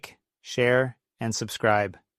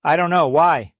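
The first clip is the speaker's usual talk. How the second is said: louder, higher shouted reply; shouting back; 5 semitones higher, 7 dB louder than usual. Retold or imitated. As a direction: yell the answer over the wind. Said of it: "I shout back".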